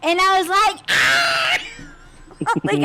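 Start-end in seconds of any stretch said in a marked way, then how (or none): 0.9-1.6 high yelped "ah" of panic; a sudden shrill yelp of fright; retold scream. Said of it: "I started screaming again".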